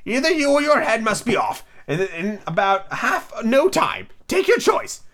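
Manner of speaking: high-pitched, imperious Queen; acted shout without much volume; Queen's shout. A narrator's reading. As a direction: deliver a royal ultimatum, pitched high. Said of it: "shouted the Queen".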